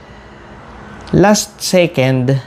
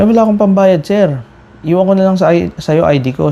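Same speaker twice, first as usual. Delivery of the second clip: pleading; 6 semitones higher than usual. Retold or imitated. Cyclist pleads no money.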